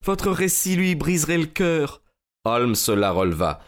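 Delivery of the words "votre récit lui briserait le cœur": high, imploring lady; tearful-lady entreaty, acted; pleading. A narrator's reading. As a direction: plead for your husband's sake in a raised pitch. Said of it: "supplia-t-elle".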